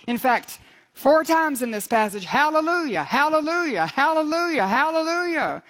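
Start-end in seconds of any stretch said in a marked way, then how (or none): 2.2-5.6 high, swooping chant-like hallelujahs; jubilant praise cry. Acted. Preacher echoing heaven's multitude four times, jubilant praise cry. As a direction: ring out each hallelujah in the same high arc. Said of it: "great multitude in heaven shouting".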